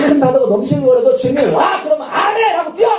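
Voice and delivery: high, shouted rallying cry; excited shouting. Acted. Preacher cheers believers to leap onto the sea. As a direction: fire the congregation up to leap. Urging a jump onto the waves.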